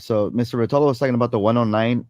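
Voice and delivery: rattled off quickly; talking too fast. Real, not acted. Host reading out viewer comments, talking too fast. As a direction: rush through it at a fast clip. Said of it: "Slow down, [name]".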